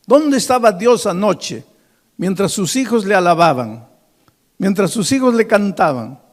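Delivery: loud, high proclaimed headline; headline cried out. Retold or imitated. Scene: a preacher voices the atheist journalists' headline, loud. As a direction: shout the mocking headline to the hall.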